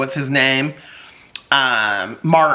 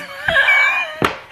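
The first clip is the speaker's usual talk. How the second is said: shrieking laugh. Real, not acted. After quoting the mayor, she shrieks with laughter. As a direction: howl with laughter.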